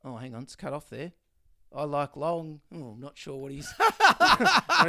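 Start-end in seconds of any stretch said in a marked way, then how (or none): 3.8-4.9 a high, loud laugh through the words. Real, not acted. Co-host cracks up reading the cut-off tortoise profile, laughing. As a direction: break up laughing mid-line.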